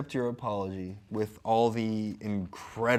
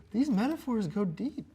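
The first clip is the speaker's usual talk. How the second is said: normal talking voice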